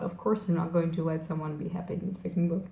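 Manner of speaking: retold thought, dropped low in volume; resigned inner thought. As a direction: give up on it under your breath. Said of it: "And then I thought".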